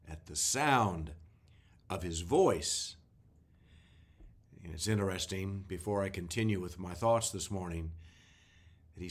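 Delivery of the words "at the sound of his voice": slow, with weight on voice; stress on voice. Shown as a demonstration. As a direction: linger on the word voice. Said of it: "I was emphasizing, the word voice".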